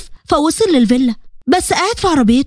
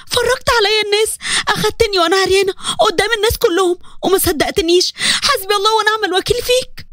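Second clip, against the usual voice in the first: higher, wounded and furious; anguished accusation; 4 semitones higher than usual. Acted. Grieving wife throwing her husband out of the room, higher and anguished. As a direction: hurl every word back at him.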